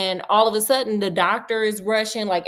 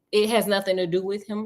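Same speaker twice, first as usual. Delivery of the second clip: ordinary talk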